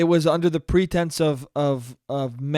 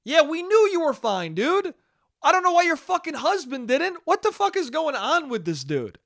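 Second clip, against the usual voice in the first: much higher, exasperated disbelief; incredulous outrage; 10 semitones higher than usual. Real, not acted. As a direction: rant at him, fed up and amazed. Outraged rant at the husband.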